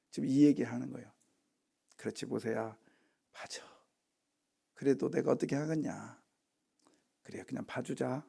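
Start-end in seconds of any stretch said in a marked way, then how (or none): 2.0-8.3 very soft, low resigned murmur; relenting, acted. Preacher plays God relenting to Moses, quiet and resigned. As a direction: give in gently under your breath.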